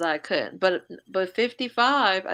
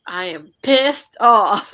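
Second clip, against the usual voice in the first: slow, loud, emphatic outburst; re-enacted angry declaration; retold fury; 10 dB louder, much slower than usual. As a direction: punch out each word, much louder. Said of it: "So I was like".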